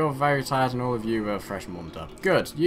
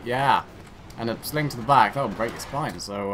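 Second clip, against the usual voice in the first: ordinary talk, nothing marked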